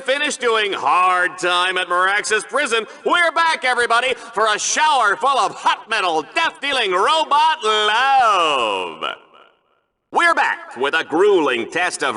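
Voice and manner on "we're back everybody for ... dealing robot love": booming game-show host welcome; hyped TV host. Acted. Host welcoming viewers back, booming showman hype. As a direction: sell the carnage like a TV host.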